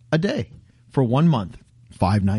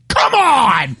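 loud exasperated cry; frustrated shout; 5 semitones higher, 3 dB louder than usual. Retold or imitated. Rant to friends, frustrated shout. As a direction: yell at the broken machine.

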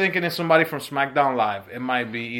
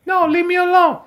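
very high, louder put-on girl voice; imitating a girl; 7 dB louder than usual. Shown as a demonstration. Showing they imitate girls alike, high mock girl voice. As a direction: squeal it as a mock protest. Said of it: "We're imitating a girl".

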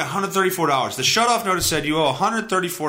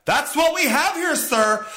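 mock agent voice; 11 semitones higher than usual. Imitating the customer service agent.

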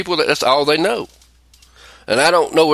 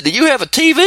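disbelieving; 11 semitones higher, 4 dB louder, faster than usual. Retold or imitated. Mocking clueless co-host on air.

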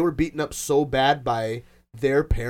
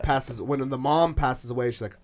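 normal talking voice

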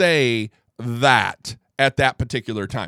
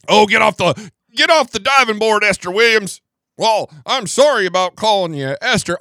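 loud, higher, hammed-up old man scolding; mocking Biden impression; 6 semitones higher, 7 dB louder than usual. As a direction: send up Joe Biden's story with a big mock voice. Mocking Biden's retold story.

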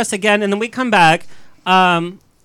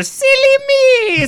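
silly high; much slower than usual. Acted. Flustered wife after bumping stranger.

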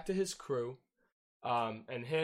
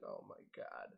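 disbelieving whisper; 12 dB quieter than usual. Retold or imitated. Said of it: "He's like".

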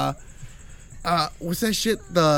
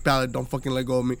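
lower, softer, fast plea; nervous plea; 6 semitones lower, 5 dB quieter, faster than usual. Retold or imitated. Begging his toddler to hold on, low and fast. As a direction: rush it out low and tense. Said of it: "I'm like".

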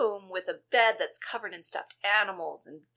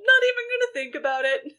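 mocking Beth; 7 dB louder, faster than usual. Mocking Beth's careless attitude.

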